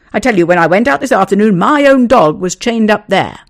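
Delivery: loud, outraged denial; indignant denial. Acted. Neighbour denying theft to the owner, loud and indignant. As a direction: fling the charge right back.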